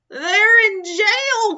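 high, loud, slow cry; dismayed cry. Acted. Pirate crying out about the jailed crew, high, loud and slow. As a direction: wail the news from the top. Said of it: "cried Dots".

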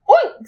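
high, short pig oink; squeaky storybook pig; piggy oink. A narrator's reading. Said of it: "said a piggy".

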